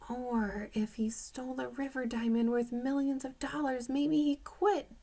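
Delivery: whispered private thought; inner thought whisper. A narrator's reading. Dink thinking to himself, whispered. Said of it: "Dink thought".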